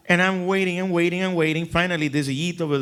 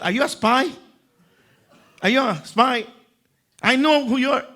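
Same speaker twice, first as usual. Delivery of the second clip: suspicious; 8 semitones higher, 4 dB louder than usual. Retold or imitated. Suspicious Yid confronting narrator.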